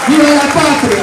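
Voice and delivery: loud rallying cry; booming balcony speech. Real, not acted. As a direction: shout it to the crowd. Rally cheer.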